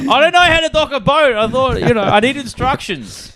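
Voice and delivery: high, whiny apologetic voice; flustered sailor. Retold or imitated. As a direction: plead like a lost kid. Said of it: "And you're like".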